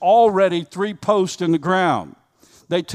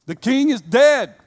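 loud, high proclamation; proclaim; 7 semitones higher, 6 dB louder than usual. Real, not acted. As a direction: ring it out over the hall. Climactic proclamation.

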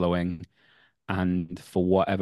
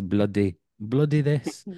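put-on Filipino mum accent; slower than usual. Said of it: "except when she says".

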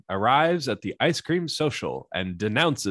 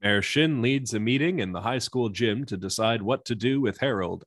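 ordinary talk

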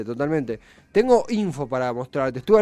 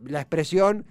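usual speaking voice

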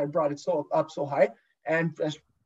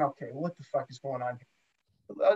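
muttered to himself; 7 semitones lower, 9 dB quieter than usual. Retold or imitated. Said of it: "I'm going".

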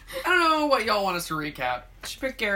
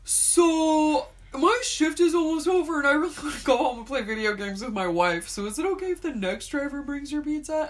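imitating delivery guy; 5 semitones higher than usual.

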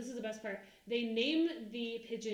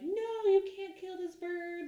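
mock outraged netizen; 8 semitones higher, 4 dB louder, slower than usual. Internet people voiced protesting.

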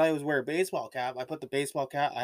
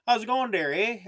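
loud, higher-pitched hammed-up Canadian accent; hardcore Canadian accent; 8 semitones higher, 6 dB louder than usual. Shown as a demonstration. Host shows the hardcore accent of Canada, hammed-up Canadian accent. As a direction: put on a thick Canadian accent. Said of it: "there's the hardcore accent of Canada".

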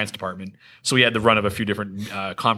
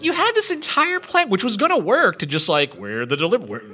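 incredulous; 8 semitones higher than usual. Real, not acted. Exasperated recap of the TPK plan.

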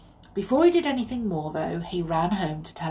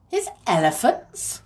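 surprised; 4 dB louder than usual. A narrator's reading. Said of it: "said Jimmy in surprise".

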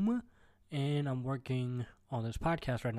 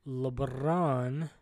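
normal talking voice

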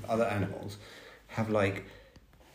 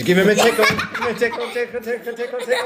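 high laughing tickle voice; giggling while tickling; giggly tickle attack; 10 semitones higher, 4 dB louder than usual. Real, not acted. Dad tickling child, laughing.

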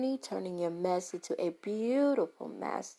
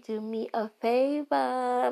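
slow, higher Brooklyn accent on favor; 5 semitones higher, 3 dB louder, slower than usual. Real, not acted. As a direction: lean into the Brooklyn accent, drawn out. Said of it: "My Brooklyn accent coming back".

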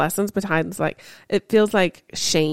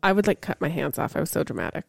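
ordinary talk

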